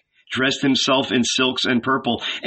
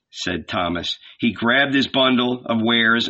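usual speaking voice

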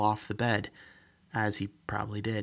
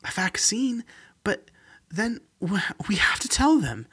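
put-on old woman voice; 10 semitones higher than usual. Emma excited about vaccine.

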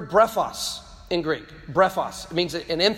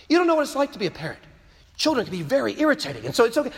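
higher-pitched, hurried mock objection; put-on defensive parent, pitched up; mock excuse-making; 5 semitones higher, faster than usual. Acting out an excuse for abuse.

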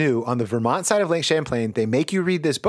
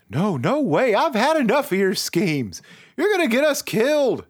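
angry refusal; 7 semitones higher than usual. A narrator's reading. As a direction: build from refusal to alarm. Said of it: "he says".

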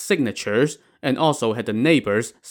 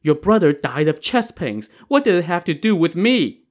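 higher-pitched denial; protesting; 6 semitones higher than usual. Acted. Accused widow denies role in husband's death, protesting. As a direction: plead innocence, rising into an indignant question. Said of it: "Pan Jinlian protested".

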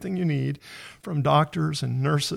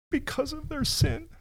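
moved, pitch climbing, almost tearful; emotional; 6 semitones higher than usual. Real, not acted. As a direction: voice rising with real emotion. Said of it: "Sorry, it makes me emotional too".

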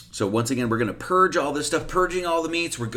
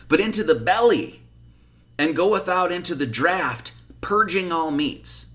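higher, slow, emphatic verse reading; scripture reading; 6 semitones higher, 3 dB louder than usual. Real, not acted. Teacher quoting Mark to his listeners, emphatic scripture reading. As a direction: read the verse out slowly and emphatically.

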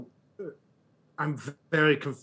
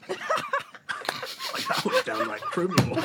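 laughing through the words; cracking up mid-sentence; much slower than usual. Real, not acted. Laughing at his own line.

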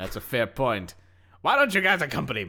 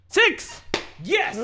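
high, loud cheer; excited shout; 4 dB louder than usual. Real, not acted. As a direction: shout in triumph. Cheering a dice roll.

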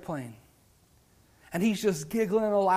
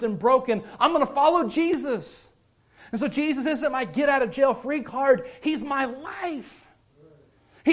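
higher, driving sermon delivery; impassioned preaching; 5 semitones higher, 3 dB louder than usual. Real, not acted. Preacher declaring he will follow Jesus, impassioned. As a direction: preach it with rising urgency. Preacher testifies with rising force.

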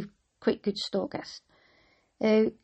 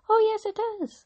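high, shouted panto reply; panto crowd callback; 3 dB louder, faster than usual. Pretend. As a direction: call it back like a panto crowd.

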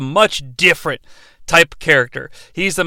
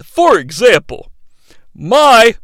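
normal talking voice

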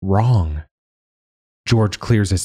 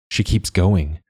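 plain reading voice

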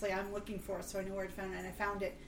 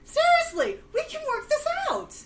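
high sweet-talking voice; 10 semitones higher, 4 dB louder than usual. Acted. Player bargains in character.

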